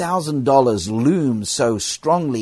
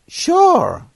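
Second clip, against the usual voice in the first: Joe's reply; 6 dB louder than usual. Retold or imitated. Joe answering the apology casually.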